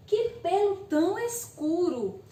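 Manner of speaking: exclamative, Que pelo tão escuro, said with wonder; exclamation. Shown as a demonstration. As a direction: exclaim it with surprise. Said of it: "frase exclamativa, ela vai exprimir surpresa".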